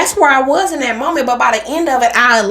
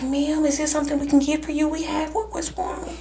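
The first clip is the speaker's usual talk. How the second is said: worried cashier; 6 semitones higher than usual. Retold or imitated. Drive-through lady fussing over the crying customer, higher and laughing. Cashier voiced in retold story.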